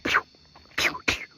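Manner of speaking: unvoiced-lipped "pew" air rifle shots; short, soft puffs mimicking shots; imitated gunshots. Rider recounting air rifle noises in the jungle, imitated gunshots. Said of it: "it sounded like someone was shooting".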